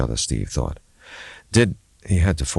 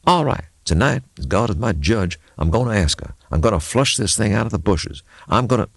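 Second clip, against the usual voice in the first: put-on higher, firm old mechanic vowing; determined mechanic; 5 semitones higher than usual. Levi vowing to confront Maggie, determined. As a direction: vow it like a man making up his mind.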